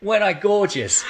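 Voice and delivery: put-on high, playful old-timer admiring themselves; hammed-up nostalgic boast; nursing home resident. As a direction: reminisce proudly in a playful elderly voice. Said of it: "You'd be sat there in the nursing home".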